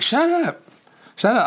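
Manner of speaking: high-pitched imitation of an irritated yell; mock yelling. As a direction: imitate someone snapping in a high voice. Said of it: "I hear Brittany through the door yelling".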